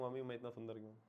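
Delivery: put-on a child asking mummy, softly and fast; lightly voiced kid, hushed and hurried; a hint of a child's question, quiet and quick; quick, quiet mock child asking mum.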